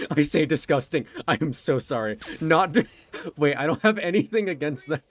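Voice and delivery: loud, high, laughing panic; flustered apology. Real, not acted. Streamer scrambling to apologise after insulting an accent, loud and flustered. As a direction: backpedal loudly through laughter.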